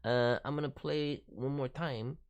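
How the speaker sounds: ordinary talk, nothing marked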